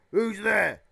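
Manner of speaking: loud, high shout; shouted challenge. Acted. Uncle Vernon with a rifle to whoever knocked, shouting. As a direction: shout it out at an unseen intruder. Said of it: "he shouted".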